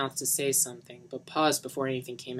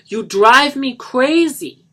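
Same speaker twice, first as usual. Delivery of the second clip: high, loud, very slow exasperated cry; exasperated outburst; 11 semitones higher, 15 dB louder, much slower than usual. Acted. Johnny calling up the stairs after Lisa, slow exasperated cry. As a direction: let the frustration burst out.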